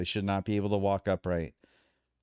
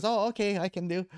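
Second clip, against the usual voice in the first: put-on much higher Thai accent; imitating Thai trainer; 10 semitones higher than usual. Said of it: "He goes".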